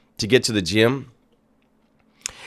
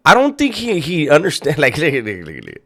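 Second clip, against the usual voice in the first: ordinary talk, nothing marked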